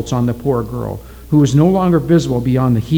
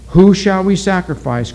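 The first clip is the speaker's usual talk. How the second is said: higher, mildly raised ritual question; crying out; 6 semitones higher, 4 dB louder than usual. A narrator's reading. Witch doctor asks the villagers, crying out. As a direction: put the question to the crowd. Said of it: "cried the witch doctor".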